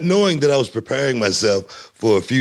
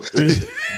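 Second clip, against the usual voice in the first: high laugh. Real, not acted. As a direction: let a big giggle rise. Laughing at own story.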